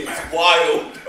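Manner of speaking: ordinary talk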